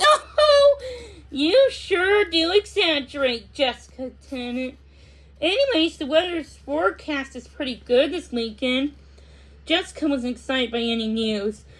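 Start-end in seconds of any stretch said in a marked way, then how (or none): 0.0-9.0 teasing. Acted. Mom teasing after laughing.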